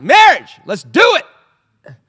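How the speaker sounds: loud and high with wild enthusiasm; eager Solomon. Acted. Solomon cheering on marriage, an over-the-top excited yell. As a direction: shout it like a gleeful king ready to wed. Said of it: "Solomon was like".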